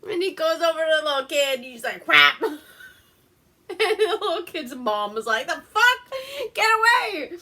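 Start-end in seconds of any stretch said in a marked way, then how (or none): 2.0-2.4 sudden loud burst of sound; imitated crash noise. Imitating creature lunging at little kid. 5.5-7.4 high, loud mock shriek; shouted in high-pitched alarm; alarmed mom yell. Kid's mom reacting, retold.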